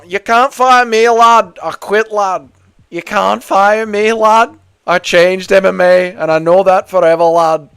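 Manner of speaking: imitating Molly McCann's Scouse defiance; mock Scouse accent. Voicing Molly McCann quitting.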